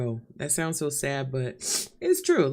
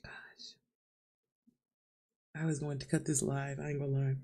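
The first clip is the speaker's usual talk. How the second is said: soft, choked-up voice; barely holding back tears; real quiet weeping; crying quietly; 9 dB quieter than usual. Real, not acted. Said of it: "cry so much".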